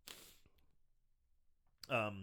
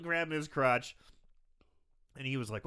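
ordinary talk, nothing marked

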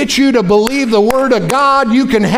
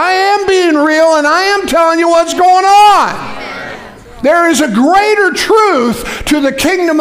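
preaching at full force; 6 semitones higher than usual. Real, not acted. Preacher answering doubters forcefully.

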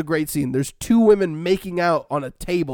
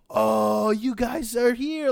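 high-pitched, drawn-out mock delight; gushing greeting; 5 semitones higher, much slower than usual. Giorgio greets the guys and their partners, gushing. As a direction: gush as if thrilled to see them.

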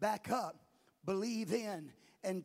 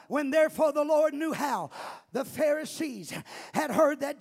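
loud shouted call-out preaching; rally the crowd, then preach; preaching at full force; 6 semitones higher, 10 dB louder than usual. Acted. Call and response, scripture reading.

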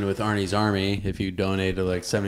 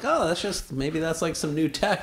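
casual musing; 9 semitones higher than usual. Retold or imitated. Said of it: "I'm like".

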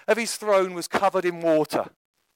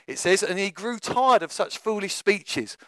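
ordinary delivery

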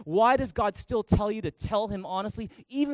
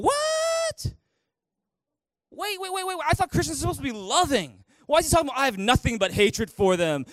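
high, startled mock outrage; shocked newcomer; 6 semitones higher than usual. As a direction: play a stunned first-time reader. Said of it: "Some people who are new to the Bible will be like".

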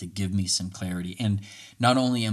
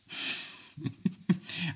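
breathy laugh; 10 semitones higher, 5 dB quieter than usual. Real, not acted. Laughs at his own impression.

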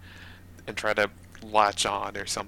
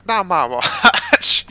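loud, slow and higher; slow booming hero line; heroic declaration; 10 semitones higher, 12 dB louder, slower than usual. Acted. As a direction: plant your feet and declare it. Prairie dog heroic stand.

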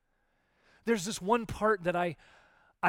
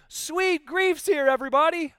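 much higher, loud, giddy cheer; mock delight; 11 semitones higher, 9 dB louder than usual. Preacher mocking someone thrilled that grief has arrived, high and loud. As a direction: announce it like happy news at a party. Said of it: "We shouldn't be like".

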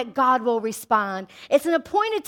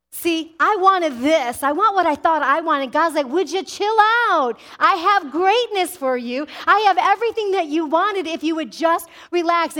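louder, raised, slowed start; fervent preaching; 5 semitones higher, 5 dB louder than usual. Acted. Preacher to congregation, God telling him chill out, loud and fervent. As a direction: preach it with rising fire.

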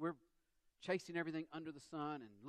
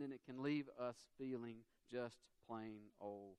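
ordinary talk, nothing marked

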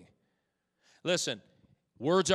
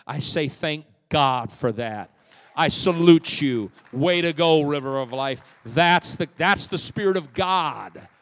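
loud, slowed pulpit praise; full-force preaching; 2 semitones higher, 14 dB louder, slower than usual. Acted. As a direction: salute the church with a ringing shout.

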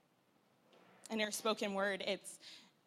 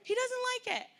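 exasperated mom scold; 8 dB louder than usual. Retold or imitated. Mother scolding daughter in the car, high loud exasperation. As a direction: snap at her like a tired mom. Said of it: "Finally, I'm like".

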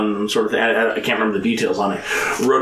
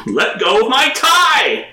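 mock yell; 13 semitones higher, 5 dB louder, slower than usual. Quoting a yelled film line.

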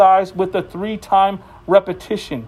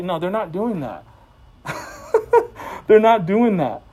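usual speaking voice

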